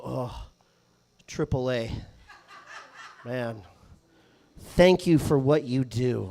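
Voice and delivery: lightly voiced old man, low and slow; hushed, heartfelt thanks from an older man; reverent old guy.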